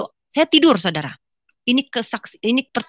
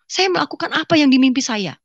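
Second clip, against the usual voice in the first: ordinary talk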